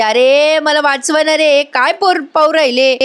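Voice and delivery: half-voiced retold yelling; mock cry for help. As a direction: imitate a girl calling out for rescue. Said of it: "मोठ्याने ओरडायला लागते".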